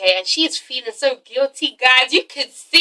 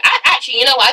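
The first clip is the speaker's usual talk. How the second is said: ordinary delivery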